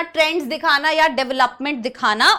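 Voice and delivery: usual speaking voice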